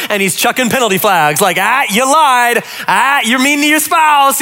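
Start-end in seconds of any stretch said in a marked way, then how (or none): 1.6-4.4 slow, higher, hammed-up referee calling fouls; mock referee God. Pastor mocking a referee God, slow and hammed-up. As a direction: blow the whistle on sinners, playfully. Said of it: "God's like the referee".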